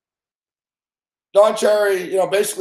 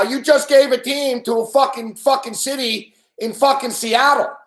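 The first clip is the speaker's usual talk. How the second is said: higher-pitched heated rant, swearing hard; angry rant; 5 semitones higher than usual. Real, not acted. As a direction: vent real outrage at the commissioner. Ranting at Bettman over Quebec.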